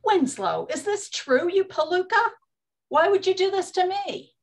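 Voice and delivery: stung by betrayal; betrayed outrage. Acted. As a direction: demand an answer from the traitor. Betrayed by supplier.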